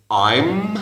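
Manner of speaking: I'm ending on a held M with closed lips; closed final m. Shown as a demonstration. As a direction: close the mouth fully on the m of I'm. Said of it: "cierra bien la boca a la final". (I'm, con la boca bien cerrada al final)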